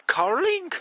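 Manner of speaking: a loud, shrill cry of astonishment, jumping way up in pitch; loud high squeal. Real, not acted. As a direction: shriek it in disbelief. Amazed at the Carling sign.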